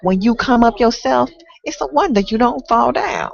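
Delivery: aggrieved tenant pleading with the landlord; complaining tenant. Acted. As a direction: recite as a fed-up tenant, lifting into indignation. Performs the tenant in a poem.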